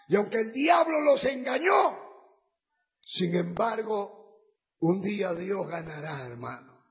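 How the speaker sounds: loud, fervent sermon with shouts; fiery preaching. Acted. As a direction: preach it out to the crowd. Predicación a pleno pulmón. (predicación ferviente a gritos)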